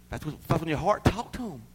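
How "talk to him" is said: ordinary delivery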